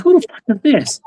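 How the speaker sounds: high incredulous who the fuck; mock baffled squeak; retold disbelief.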